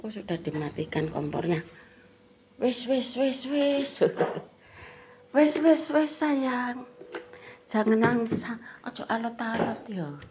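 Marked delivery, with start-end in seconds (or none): 2.6-6.9 higher, sing-song hushing "wis, wis". Real, not acted. Comforting crying child, high baby talk. 7.7-10.2 higher, gentle coaxing. Real, not acted. Comforting crying child.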